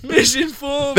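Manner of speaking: high, shouted echo of the word; hammed-up outraged cry; mock conspiracy rant. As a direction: throw it out again, mocking. Repeated mocking cry.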